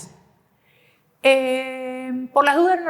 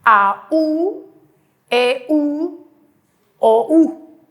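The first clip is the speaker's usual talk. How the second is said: loud spelled-out vowels; 2 semitones higher, 4 dB louder, much slower than usual. Shown as a demonstration. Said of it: "O al revés".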